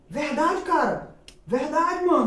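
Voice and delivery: high, loud, incredulous check; retold amazement. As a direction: act out being astonished by the news. Retells his amazed reaction. (espanto encenado, voz alta e aguda)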